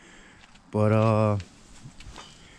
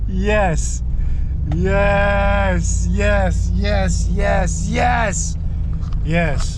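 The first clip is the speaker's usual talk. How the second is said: ecstatic yeses; 8 semitones higher, much slower than usual. Real, not acted. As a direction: cheer each yes. Reacting to the rev.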